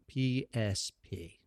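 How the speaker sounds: ordinary delivery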